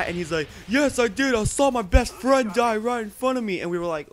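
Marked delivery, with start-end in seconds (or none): 0.7-3.6 loud, high mock veteran's cry; distraught outburst. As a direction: blurt it out loudly, imitating an upset veteran. Veteran's outburst about friend's death.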